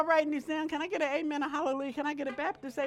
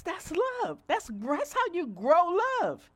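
ordinary delivery

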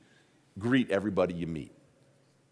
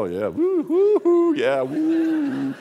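eye-rolling mock "woo", swooping much higher; sarcastic spooky woo; 8 dB louder, much slower than usual. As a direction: scoff at a ghost story with mocking woos. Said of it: "turn my head, roll my eyes, and go".

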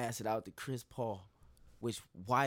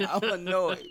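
laughing, louder, drawn out I'm annoyed; higher, slower, laughing I'm annoyed; 8 semitones higher, 6 dB louder than usual. Real, not acted. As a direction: laugh through the complaint.

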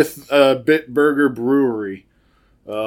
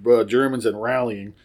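normal talking voice

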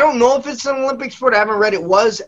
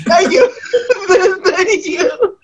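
laughing thanks; 8 semitones higher, slower than usual. Real, not acted. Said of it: "Pretty good".

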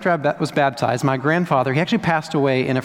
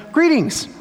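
"Greetings." lifted much higher in a grand tone; Jesus greeting; 12 semitones higher than usual. Retold or imitated. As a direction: say "Greetings." high and stately. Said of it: "and he says".

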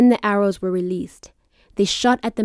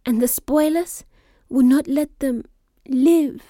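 voice breaking; 6 semitones higher than usual. Acted. Fearful line about parents' fate.

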